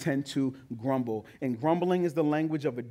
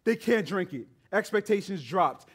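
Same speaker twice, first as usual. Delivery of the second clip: rising, louder preacher's build; preaching at full force; 4 semitones higher, 4 dB louder than usual. Acted. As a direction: preach it with growing fire.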